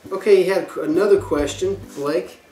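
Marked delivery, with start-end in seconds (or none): none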